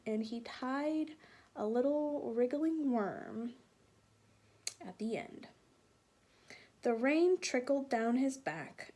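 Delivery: put-on lively read-aloud voice for children; storybook narration. A narrator's reading. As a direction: tell it like a bedtime story. Continues reading the story aloud.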